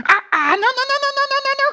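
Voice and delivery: high, rapid stammering little dog; trembling with fright. Acted. Scared little dog refusing to go to bed, trembling. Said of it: "tu trembles comme une feuille".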